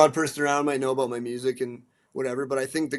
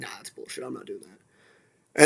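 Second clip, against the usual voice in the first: slow, muttered brush-off; flat refusal, quiet and dragged out; dismissive; 7 dB quieter, slower than usual. Retold or imitated. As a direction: wave the idea away, much softer and slow. Rejecting the advised terminology.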